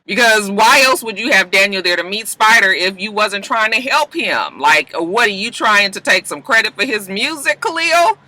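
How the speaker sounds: worked-up, accusing rant; mad on his behalf. Real, not acted. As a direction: challenge him, pitch climbing to the end. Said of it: "I was mad for Daniel".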